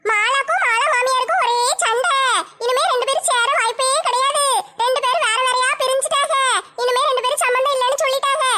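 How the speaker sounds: delighted busybody savouring the quarrel; sly neighbour's gleeful aside; scheming gossip neighbour. Acted. Gossip neighbour spreads news.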